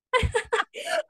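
high burst of real laughter; amused giggle. Real, not acted. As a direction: laugh at your own accent. Laughing at her own accent.

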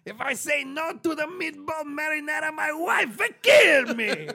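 high shouting Italian mobster; Italian mafioso accent. Pretend. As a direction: bellow it like a mob husband. S1 joins the Italian bit.

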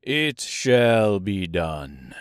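low, very slow obedient king; King of the Monkeys. Acted. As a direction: pledge it low, word by word. Monkey king obeys the girl.

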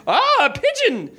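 high, gleeful exclamation; delighted. A narrator's reading. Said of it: "in a delighted tone".

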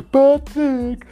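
Patryk called out high and loud; sneering shout of the name; mock call. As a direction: call him in from outside.

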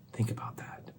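near-whisper; hushed reflection. Real, not acted. Preacher urging listeners to ponder God's quiet voice, hushed. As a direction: drop almost to a whisper, let it sink in.

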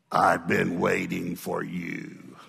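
low, slow and menacing, a voice not her own; demonic voice. Retold or imitated. Possessed woman greeting the pastor, low slow demonic voice. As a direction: let something dark speak through her, low and drawn out. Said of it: "a voice came out of her that was not her voice".

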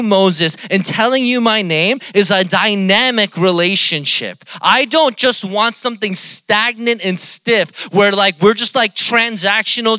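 impassioned, high and loud sermon delivery; full-force preaching. Acted. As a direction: preach it out to the whole room.

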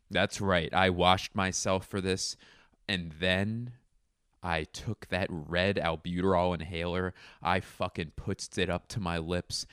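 normal talking voice

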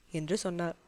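plain reading voice

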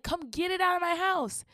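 high-pitched mock-desperate demand; hammed-up panicked order; frantic plea. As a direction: beg for urgent help. Acted frantic demand to neighbour.

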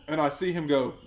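normal talking voice

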